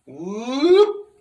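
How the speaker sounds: a big rising whoop pushed out on the breath; human whoop. Shown as a demonstration. Showing viewers the human whoop, loud and high. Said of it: "all humans whoop like this".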